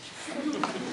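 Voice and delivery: soft, high breath of laughter; amused little laugh. Real, not acted. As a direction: let out a quick, quiet giggle. Laughing at the crazy guy story.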